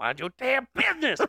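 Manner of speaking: high mock-indignant Southern voice; indignant retort. Boy answering the intrusive old man, mock indignant. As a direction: snap back in mock outrage. Repeats boy's imagined retort.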